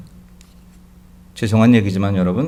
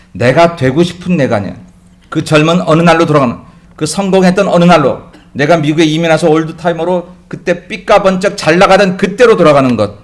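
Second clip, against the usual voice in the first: loud, high preaching climax; fiery preaching; 6 semitones higher, 8 dB louder than usual. Acted. Pastor rousing the congregation with a loud, high sermon climax. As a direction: preach it to the congregation at full force.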